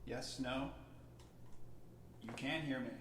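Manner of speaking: normal talking voice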